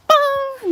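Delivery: high mimicked warning chime; ping it out like a dashboard alert; imitating the car's fuel warning bong.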